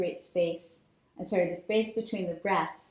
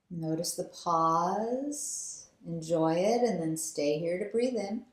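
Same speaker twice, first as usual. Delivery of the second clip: guided breathing meditation. Acted. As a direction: lead them gently through each breath. Leads alternate nostril breathing.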